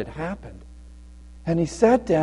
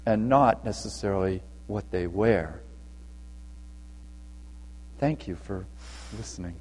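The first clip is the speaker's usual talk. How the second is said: much lower and softer; hushed closing; 9 semitones lower, 12 dB quieter than usual. Real, not acted. Storyteller ends the lesson for the children, low and soft. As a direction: let the moral settle quietly.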